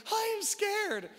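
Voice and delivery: put-on high, small child saying she's scared; little girl's voice. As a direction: voice a frightened little daughter. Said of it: "London was like".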